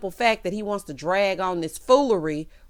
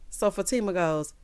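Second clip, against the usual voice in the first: usual speaking voice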